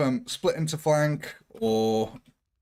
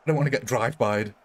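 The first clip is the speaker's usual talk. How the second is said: ordinary delivery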